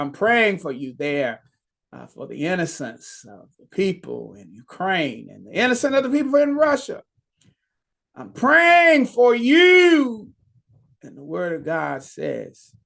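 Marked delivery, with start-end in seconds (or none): 5.5-10.3 fervent prayer cry. Real, not acted. Preacher prays for the suffering.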